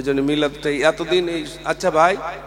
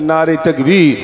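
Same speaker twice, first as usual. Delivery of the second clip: loud falling cry of তাকবীর; shouted call to the crowd; takbir call; 9 dB louder, slower than usual. Real, not acted. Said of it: "একটু তকবির দেন".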